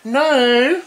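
loud insistent. Real, not acted. Arguing with S1 over parts.

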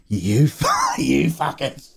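half-laughing curse; laughing insult. Real, not acted. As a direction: curse them through a laugh.

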